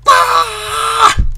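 high, loud scream of defeat; frustrated scream. Real, not acted. Fails near the goal.

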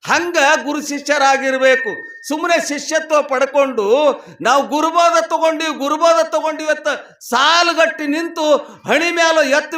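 high, loud sustained sermon delivery; full-voiced preaching. Real, not acted. Preacher explaining guru initiation to devotees, full-voiced preaching. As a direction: proclaim it to a whole congregation. Preaches against showy disciples.

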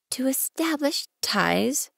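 put-on a small boy wondering aloud; childlike voice, gently asking; curious little prince. Narrator voicing little prince.

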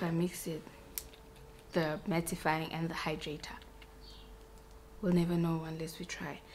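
soft whispered aside. Real, not acted. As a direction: whisper it to yourself. Whispered aside while mixing makeup.

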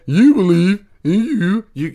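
high, loud, goofy put-on voice; exaggerated comic hooting; silly mock voice. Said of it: "someone who talks like that".

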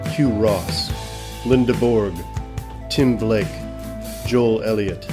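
ordinary talk

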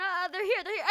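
normal talking voice

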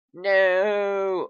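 high, loud drawn-out protest; dismayed. Real, not acted. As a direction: wail it in frustration. Hits a dead end.

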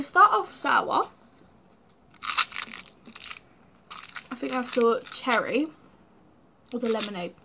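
sucking small candy; talking while tasting sweets; eating candy. Real, not acted. Seen 3: Holds box; pursed lips suggest candy, mouth contents unseen.

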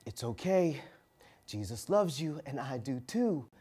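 put-on a man gently reassures the screaming girl, very softly; gentle reassurance. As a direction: soothe her after a deep breath. Took a deep breath and said.

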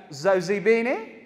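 ordinary talk, nothing marked

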